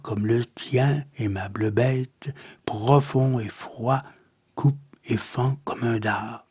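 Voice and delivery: measured, subdued poem reading with pauses; solemn, restrained verse reading; poetry recitation. Acted. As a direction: recite verse softly and evenly, letting each line settle.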